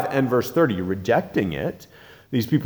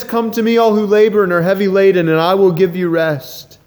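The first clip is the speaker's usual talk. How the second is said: fervent preaching; 7 semitones higher, 7 dB louder than usual. Acted. Preaching Jesus' invitation loudly.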